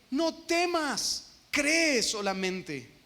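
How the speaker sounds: very slow, each word laid down gently; preacher draws out the Lord's command, slowly; slow Jesus quote. Acted. Preacher to congregation, Jesus' words drawn out very slowly.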